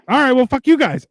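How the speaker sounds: exasperated retort. Retold or imitated. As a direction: throw it off in disgust. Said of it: "And I'm just like".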